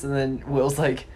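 normal talking voice

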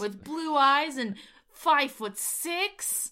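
slow, high, loud rising mock disbelief; incredulous disbelief. Podcaster mimicking Busey reading her description, incredulous. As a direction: drag out each detail as if unbelievable. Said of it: "in such incredulous disbelief".

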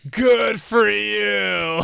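long drawn-out mock cheer. Sarcastic mock cheer for Rich.

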